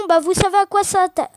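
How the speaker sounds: normal talking voice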